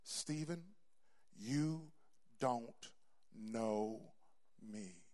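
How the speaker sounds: low, soft, slow divine voice; hushed voice from heaven; voice of the Lord. Retold or imitated. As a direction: speak each word apart, hushed and grave. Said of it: "He said these words".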